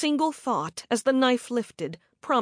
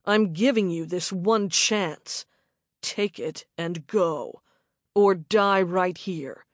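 menacing man's threat; 4 semitones lower than usual. A narrator's reading. Attacker threatens her.

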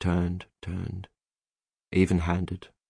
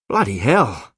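loud, stunned exclamation; exclaiming; 2 semitones higher, 6 dB louder, slower than usual. A narrator's reading. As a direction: exclaim in shock, pitch swooping. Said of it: "he exclaimed".

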